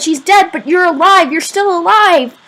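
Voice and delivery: high-pitched, frantic relief; giddy, keyed-up cry; elated insistence. A narrator's reading. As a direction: insist on it, overjoyed. Still shouting in relief at father.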